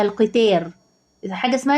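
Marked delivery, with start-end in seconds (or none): 0.0-0.7 "القتار" with a thin plain t in place of ط; misspelled light ta. Shown as a demonstration. Teacher reading out the spelling mistake, "القتار" with light t. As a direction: read the error literally, light t in "القتار". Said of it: "هو كاتبها يا ميس اسمها".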